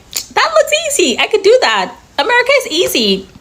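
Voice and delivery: high, breezy voice of a naive newcomer; mocking the overconfident foreigner. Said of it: "been like".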